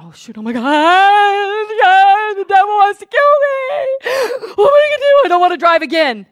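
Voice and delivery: high, crying panic over being killed; fearful sobbing whine; mock panicked crying. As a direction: wail in hammed-up fear. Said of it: "did I sit about".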